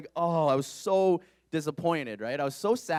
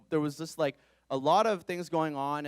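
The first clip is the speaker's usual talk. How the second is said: ordinary talk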